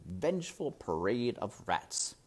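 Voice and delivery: plain reading voice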